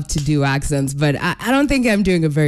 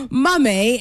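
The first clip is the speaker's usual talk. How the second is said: higher-pitched put-on British accent; 5 semitones higher than usual. Shown as a demonstration. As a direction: toss out one British word in a lifted voice. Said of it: "that's as far as my British accent goes".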